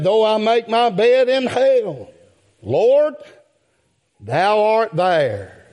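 preacher crying out David's words; rising preaching cry. Real, not acted. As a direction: build to a ringing cry.

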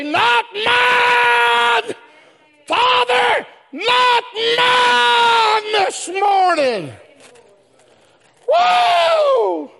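high, sustained pulpit shout; full-force preaching. Real, not acted. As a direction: cry it out with drawn-out words. Preacher shouts the retold words.

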